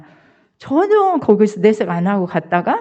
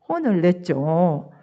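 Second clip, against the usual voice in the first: ordinary talk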